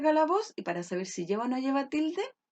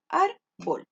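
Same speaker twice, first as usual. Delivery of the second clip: "Árbol" in two separate syllables, ÁR raised, bol dropped; syllable split; 5 semitones higher than usual. Shown as a demonstration. Teacher shows pupils the two syllables, split apart. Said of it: "tiene dos sílabas".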